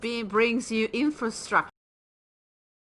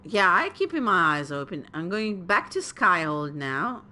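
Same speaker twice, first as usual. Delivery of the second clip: ordinary delivery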